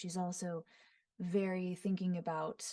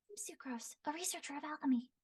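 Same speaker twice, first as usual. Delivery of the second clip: high, soft, fast and airy; Sucrose's voice; 8 semitones higher, 9 dB quieter, faster than usual. Shown as a demonstration. Voice actor demoing a nervous alchemist girl, high and quick. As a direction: rush it out shyly, higher. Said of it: "very quick, high-pitched, airy".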